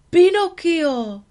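high, loud, slow call of the name; astonished cry. Acted. Geppetto finds the puppet alive and cries its name. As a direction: shout the name in disbelief.

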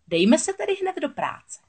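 high, relishing witch voice; eager sea witch. A narrator's reading. Witch starting work after the signing, eager. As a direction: swoop up high with scheming eagerness.

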